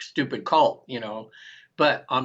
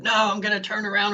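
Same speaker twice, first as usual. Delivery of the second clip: animated refusal; 8 semitones higher than usual. Retold or imitated. Refusing the facilitator in trance, animated higher defiance. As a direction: push back hard, lit up in trance. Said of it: "I'm this animated in trance".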